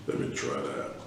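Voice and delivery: ordinary delivery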